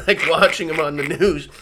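ordinary talk

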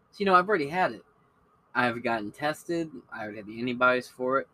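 usual speaking voice

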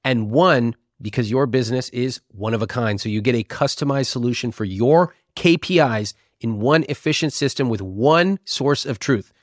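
upbeat sponsor read. Acted. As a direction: read it like a podcast ad.

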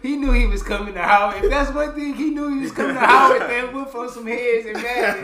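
loud, high, laughing. Real, not acted. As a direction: tease him while cracking up. Laughing, teasing friend.

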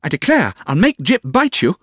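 put-on very high, loud girlish threat; pouty childish outburst; childish sweetheart. A narrator's reading. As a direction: squeal a petulant mock threat. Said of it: "Her childish way".

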